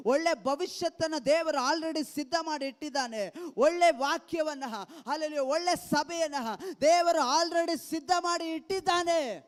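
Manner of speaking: loud, fervent preacher's build; preaching at full force. Acted. Preacher rousing the congregation with God's promise, fervent and loud. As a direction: proclaim it to the whole church.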